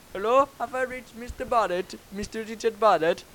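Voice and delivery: high-pitched Indian cold caller; Indian accent. Retold or imitated. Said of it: "'cause it was an Indian bloke".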